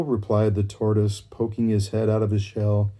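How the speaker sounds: ordinary delivery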